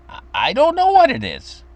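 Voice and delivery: put-on small boy whining to his mother over the letters; upset little kid. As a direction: play the fed-up first grader who doesn't know. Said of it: "And I'd start to get upset".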